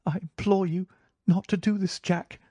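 anxious entreaty in a lighter voice; imploring cry. A narrator's reading. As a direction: beg him earnestly, distressed. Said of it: "she cried".

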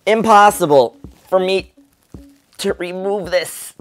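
straining. Real, not acted. Struggling to pull magnets apart.

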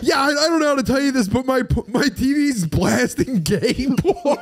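high, fast words breaking into real laughter; can barely get the quote out for laughing; laughing through it. Real, not acted. Telling friends about the prank call, laughing through the words.

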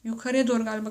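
ordinary delivery

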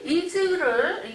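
ordinary talk

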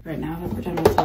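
usual speaking voice

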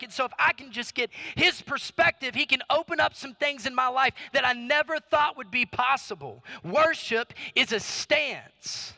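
defiant, driving declaration of faith; impassioned preaching. Acted.

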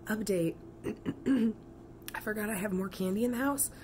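hoarse sick voice; talking with a cold, sick voice. Real, not acted. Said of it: "don't mind my sick voice".

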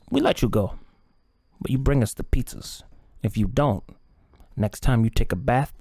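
laughing then menacing Spanish-flavoured gangster; a mob leech striking a deal; cartel boss voice. Acted. Boss agrees then threatens.

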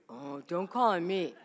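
normal talking voice

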